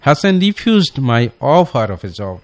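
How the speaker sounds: Hasan refused my offer of a job, drawn out; slow corrected version; slow dictation. Shown as a demonstration. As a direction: dictate the corrected sentence slowly. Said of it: "সে এটাকে refuse করলো".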